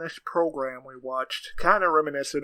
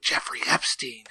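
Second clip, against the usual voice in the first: whisper; 2 semitones lower, 5 dB quieter than usual. Acted. Joke line whispered.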